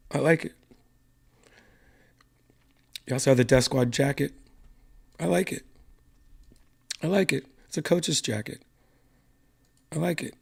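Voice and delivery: hushed, saving a sore throat; talking softly to spare voice. Real, not acted. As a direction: keep it quiet and easy on the voice. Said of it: "I'm just going to talk like this".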